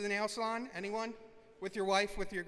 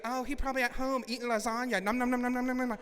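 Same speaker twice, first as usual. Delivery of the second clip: higher-pitched mocking lady voice; quick, pitched-up teasing impression; salon lady; 4 semitones higher, 4 dB louder, faster than usual. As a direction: imitate a gossiping salon worker, rattled off and ending in gobbling noises. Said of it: "and the lady, she's like".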